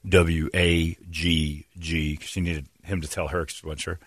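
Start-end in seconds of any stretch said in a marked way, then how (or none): 0.1-2.2 slow, lower letter-by-letter readout; phone agent slowly spelling a policy number. Retold or imitated. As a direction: read each letter out separately for a customer. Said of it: "he started to hand it out".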